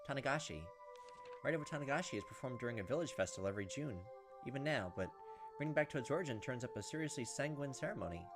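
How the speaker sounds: usual speaking voice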